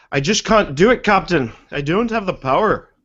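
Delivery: put-on playful Scottish-engineer accent, swooping tune; hammed-up Scottish plea to the captain; mock Scottish accent. Host quoting Scotty to his captain, mock Scottish accent. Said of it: "Or Scottish, that's right".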